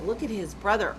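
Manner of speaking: usual speaking voice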